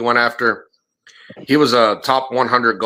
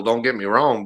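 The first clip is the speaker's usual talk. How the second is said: usual speaking voice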